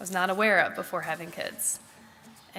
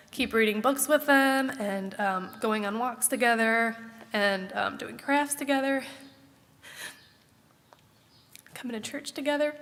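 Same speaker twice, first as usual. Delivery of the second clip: still emotional; 3 semitones higher than usual. Real, not acted. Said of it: "I did not know I was gonna get emotional".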